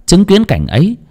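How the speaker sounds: ordinary delivery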